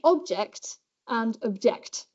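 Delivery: first OBJECT stressed on OB, second OBJECT on JECT; contrasting stress. Shown as a demonstration. Lecturer showing a word pair, OBJECT noun then OBJECT verb stress. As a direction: shift the stress between OBJECT and OBJECT. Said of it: "like stress in English".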